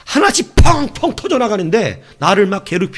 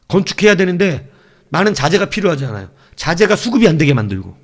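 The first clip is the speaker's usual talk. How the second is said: ordinary talk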